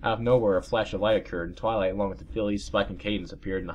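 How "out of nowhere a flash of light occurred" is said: ordinary delivery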